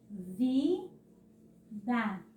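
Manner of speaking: Vida drawn out as rising VI, pause, DA; syllables separated. Shown as a demonstration. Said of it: "Na hora de separar as sílabas". (Vida separado: vi, pausa, da)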